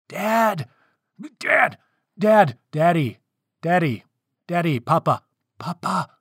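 high, insistent repeated calls; pestering for attention. A narrator's reading. Princess trying to get her father's attention, pestering. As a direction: nag away until it becomes a loud high call. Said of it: "trying to get his attention".

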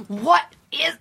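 long, breathless, high gasp of amazement; real food rapture, drawn out and high; overcome by a cookie. Real, not acted. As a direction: stretch it out slowly, stunned by how good it tastes. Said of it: "I'm having a physical reaction".

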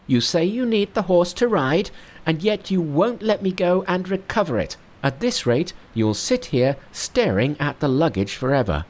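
high monkey voice, mild mock fury; exasperated monkey, impossible twice; Monkey thundering. Monkey rages at his fearful master, high mock fury. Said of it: "angrier than ever".

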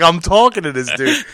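ordinary talk, nothing marked

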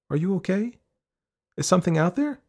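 put-on high child's voice, questioning; concerned girl. A narrator's reading. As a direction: check on a whining friend in a high voice. Girl questioning her whining friend.

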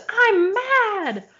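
high-pitched mock child's scream; retold tantrum scream. Mother mimics her four-year-old's store tantrum, screaming. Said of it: "she's screaming, and she said".

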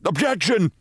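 high ringing courtroom shout; shouted objection. A narrator's reading. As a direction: cry out to stop the witness. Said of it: "shouted Mitty's attorney".

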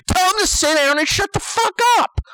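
high-pitched, indignant outburst; worked-up comic rant. Acted. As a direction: build to an exasperated high pitch. Ranting about the parent.

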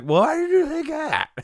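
very high, slow and bewildered; high baffled drugged question. Retold or imitated. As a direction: ask it high and slow, genuinely puzzled. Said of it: "I'm like".

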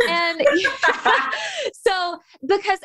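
laughing slowly through the words; a real laugh breaking into the sentence. Real, not acted. Laughing at her own boast to her friend.

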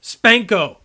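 loud, high shouted catchphrase; retold shout. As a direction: shout it out like a gleeful battle cry. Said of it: "he would sometimes shout".

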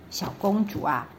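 ordinary delivery, nothing marked

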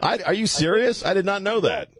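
high-pitched disbelief; astonished. Real, not acted. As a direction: blurt it in real amazement. Astonished at the caller's revelation.

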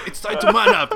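high loud laughing English; mock tough line. Kicking his little brother, a loud mock tough order in English. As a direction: deliver it as a mock macho order. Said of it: "Le dije".

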